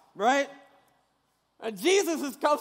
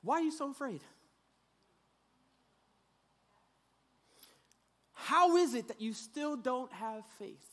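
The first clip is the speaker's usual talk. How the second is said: softer, disappointed parental question; 2 semitones lower, 8 dB quieter than usual. Retold or imitated. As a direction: let them down gently like a parent. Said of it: "every parent does this".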